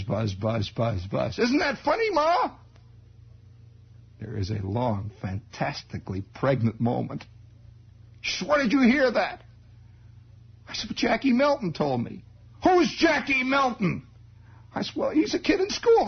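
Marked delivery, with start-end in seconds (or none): 1.4-2.5 put-on high innocent boy; boy to his mother, high and innocent; naive kid voice. 8.5-9.4 high alarmed demand; mother demanding where he heard it, high; startled mother. Retold or imitated. 10.9-16.1 put-on high boy answering; boy explaining to his mother, high; kid voice.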